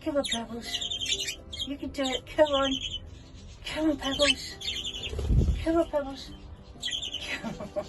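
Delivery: high, hushed coaxing; will the chick to take off; gentle urging. Real, not acted. Owner coaxing chick Pebbles to fly, high and soft.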